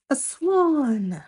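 plain reading voice

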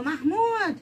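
high, drawn-out mock call for help; imitated yell. Mother showing child how she yells for help, mock shout. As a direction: holler the name as if summoning someone. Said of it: "Ich schrei dann immer".

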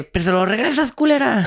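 high, loud mock yell after her; retold yell. Narrator yells at the stranger to give the phone back, mock shouting. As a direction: shout it back as you walk off. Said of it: "y le grité".

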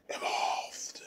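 breathy whispered word. Pretend. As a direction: hush it like a spooky secret. Eerie whispered punchline.

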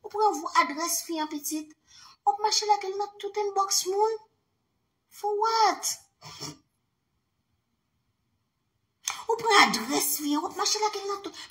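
high mocking sing-song; mock him in a high put-on voice. Ridiculing Patrice chasing the woman's address, high mocking voice.

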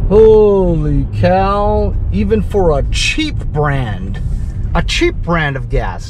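loud, slow exclamation of shock; astonished. Real, not acted. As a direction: draw it out in real amazement at the price. Shocked reaction to gas price.

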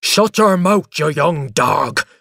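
loud, violent outburst from an angry father. A narrator's reading. Father to screaming son, violent anger. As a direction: roar it, drawing out the insult. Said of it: "he said violently".

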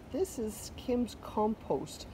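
usual speaking voice

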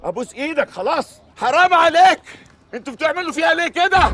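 high, rising frantic cries; plead, overwhelmed with fear; desperate pleading. Real, not acted. Terrified guest crying out at the pranksters.

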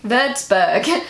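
Würzburg ending in an English -berg with hard g; English-style pronunciation. Shown as a demonstration. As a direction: anglicise Würzburg, finishing on -berg. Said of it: "a mix between the English way".

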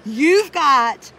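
slow, loud scolding; mock scolding. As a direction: scold him, dragging each word. Mock quarrel with husband.

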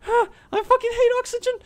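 very high, loud squeal after a gasp; panicked squeal. Real, not acted. Gamer gasping after nearly running out of oxygen, panicked squeal. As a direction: squeak it out in frantic relief.

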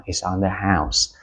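slow "It's on the house"; slow model phrase. Shown as a demonstration. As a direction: repeat it slowly for learners. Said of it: "Đó". (nhắc lại chậm rãi "It's on the house")

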